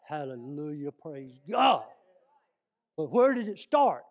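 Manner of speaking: loud, ringing praise then a booming question; preaching at full force. Real, not acted. Preacher praising God before the congregation, loud and fervent. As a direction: lift it up like a revival shout.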